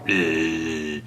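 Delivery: low mock grunt of disgust. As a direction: grumble it like a scowling neighbor. Imitates unfriendly neighbor's response.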